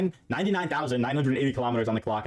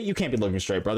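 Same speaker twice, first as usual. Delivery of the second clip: ordinary talk, nothing marked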